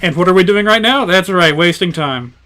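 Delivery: ordinary delivery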